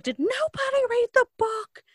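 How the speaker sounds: hammed-up outraged cry, very high and loud; freaking out. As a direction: imitate an appalled club host losing it. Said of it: "that's why she freaks out".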